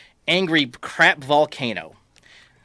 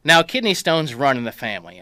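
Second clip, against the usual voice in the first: ordinary delivery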